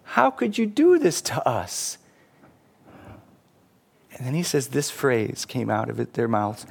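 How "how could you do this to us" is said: high, slow plaintive outcry; hammed-up hurt disbelief; wounded protest. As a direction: protest like a betrayed student. Failed students protest to professor.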